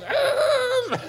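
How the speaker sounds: very high held scream; hammed-up piercing wail; mock demoniac shriek. Demoniac reacting to the name of Jesus, shrieking.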